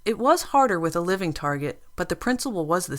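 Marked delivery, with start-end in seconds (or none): none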